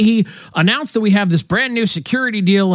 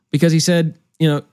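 ordinary talk

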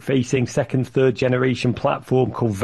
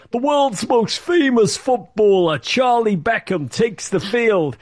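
higher, slow, grand announcer voice; slow, higher announcer voice introducing a star; mock commentator; 8 semitones higher, slower than usual. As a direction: ham up a clueless American commentator. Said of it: "the commentator said".

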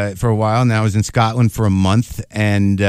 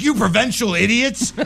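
mock indignant patriot; 11 semitones higher than usual. Comic retelling his rant at the Scots, higher with a laugh. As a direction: play the offended American abroad. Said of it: "Literally, I was like".

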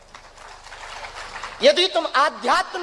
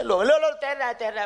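hammed-up cry of a fake miracle; mock excited showman; faster than usual. Ravan hyping the crowd, mock excited. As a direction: play the trickster hailing his own stunt.

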